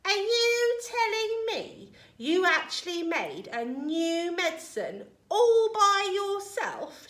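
high, loud, incredulous grandma; shouting. A narrator's reading. Grandma doubting George made medicine himself, shouting. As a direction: question it loudly with drawn-out disbelief. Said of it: "she shouted".

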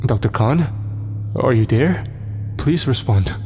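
a hint of creeping fear; confident call with a touch of worry; slightly wavering. A narrator's reading. Becca calls the doctor over the radio, slightly wavering. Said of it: "Her voice wavered slightly".

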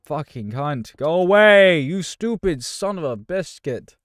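loud, high frantic yelling; angry shouting. Real, not acted. Gamer driving off an attacker in the game, angry shout. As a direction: shout it away in exasperation.

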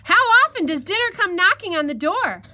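high, loud, excited marvelling; gleeful shout. Acted. Fox rejoices at dinner arriving, shouting. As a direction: shout it in delighted disbelief. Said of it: "the fox shouted".

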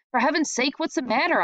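irritated big brother asking; annoyed. A narrator's reading. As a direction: snap it out impatiently. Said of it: "I asked, annoyed".

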